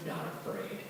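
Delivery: cold whispered vow; hushed little tough-guy line; whispered defiance. Retold or imitated. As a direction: whisper it dead into his eyes. Kid stares him down.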